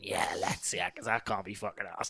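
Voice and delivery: low, soft muttered whisper; mocking whisper. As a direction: mimic lazy mums under breath. Mimicking mums, whispered.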